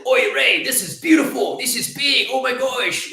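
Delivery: put-on high, shouted Scottish accent, thrilled; mock Scottish dad crowing over the ring in a high shout; Scottish father-in-law. Father-in-law sees the engagement ring, loud high Scottish accent. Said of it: "he's Scottish".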